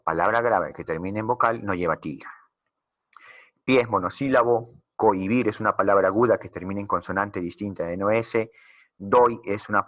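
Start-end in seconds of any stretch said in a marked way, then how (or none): none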